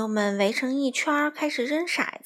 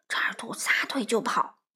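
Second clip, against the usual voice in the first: ordinary delivery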